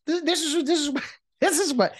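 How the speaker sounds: high, stammering mock outrage; worked-up broadcaster tripping over the words; indignant sputter.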